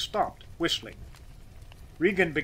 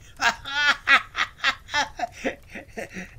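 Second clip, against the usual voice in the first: loud, high peals of laughter; roaring laughter; 11 semitones higher, 5 dB louder than usual. A narrator's reading. Boss amused by worker's wedding news, roaring laughter. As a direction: roar with a long run of guffaws. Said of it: "And again, only louder".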